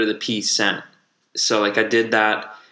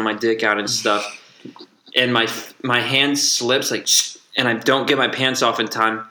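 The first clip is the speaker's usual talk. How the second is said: normal talking voice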